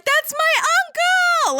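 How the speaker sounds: very high, drawn-out fangirl cry; excited squeal. Acted. Ruby instantly spots her uncle and gets so excited, high excited squeal. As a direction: stretch it out in a gleeful high-pitched shout. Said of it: "she gets so excited".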